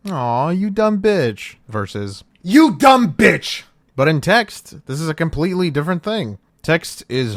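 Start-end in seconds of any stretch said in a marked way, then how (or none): 0.1-1.6 higher, slow playful tease; affectionate teasing. Shown as a demonstration. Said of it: "For example". 2.4-3.6 high, loud, slow snarling insult; angry insult. Shown as a demonstration. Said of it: "For example".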